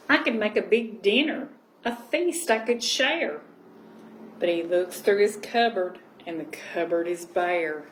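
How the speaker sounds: gentle storybook reading voice; cosy read-aloud storytelling; reading to children. Acted. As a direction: read it like a bedtime picture book. Reading picture book aloud.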